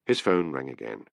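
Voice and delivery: ordinary delivery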